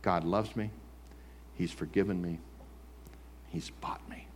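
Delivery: quiet confession. Shown as a demonstration. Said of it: "But you".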